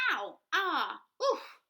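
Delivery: high, loud yelps of impact; bumping yelps. Acted. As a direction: cry out as she stumbles. Bumping into wolf.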